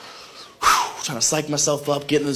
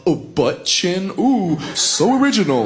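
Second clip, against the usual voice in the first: mock sarcasm; 4 semitones higher than usual. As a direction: imitate a girlfriend scoffing at a tired joke. Retold girlfriend mocks a predictable insult.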